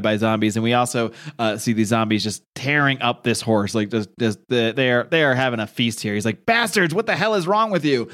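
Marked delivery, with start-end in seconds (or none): 6.5-8.1 outraged yell. Retold or imitated. Rick yelling at zombies.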